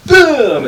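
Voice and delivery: high, punchy boom; mock impact noise. Describing the llama knocking Matt down, punchy mock boom. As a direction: hit it like a cartoon crash. Imitating the llama's head-butt.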